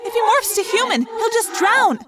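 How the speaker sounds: high, loud, fast objection; arguing. A narrator's reading. Friend arguing against a risky rescue, heated objection. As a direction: protest the plan forcefully. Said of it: "Rachel argued".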